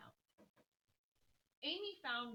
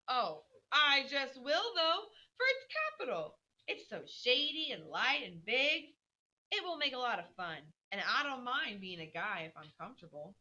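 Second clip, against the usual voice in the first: defiant Joe; 5 semitones higher, 9 dB louder than usual. Acted. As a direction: refuse happily, loving the hat. Joe defiant about hat.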